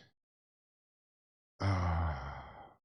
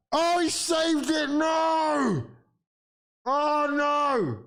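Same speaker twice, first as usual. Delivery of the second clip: anguished shout; much slower than usual. Real, not acted. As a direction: howl it out as the penalty is saved. Penalty saved in the shootout.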